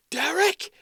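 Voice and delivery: high, loud shout for Derek across the house; panicked call to husband. Retold or imitated. Woman on the phone shouts for her husband Derek in alarm, high and loud.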